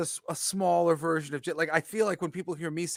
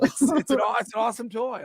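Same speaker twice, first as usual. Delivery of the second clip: laughing; 8 semitones higher, 8 dB louder than usual. Real, not acted. Laughing over the toy joke.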